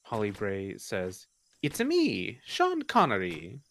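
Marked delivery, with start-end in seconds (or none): 1.6-3.6 put-on a light Mario-style Italian accent, slow; Italian accent for a Scottish name; mock Italian accent. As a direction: put on a hint of cartoon Italian. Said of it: "me doing the Italian accent".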